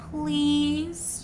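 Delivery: barely-there begging; soft plea. A narrator's reading. Bella pleading to Edward, soft plea. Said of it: "she whispered".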